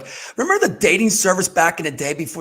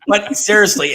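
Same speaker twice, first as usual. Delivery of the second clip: ordinary talk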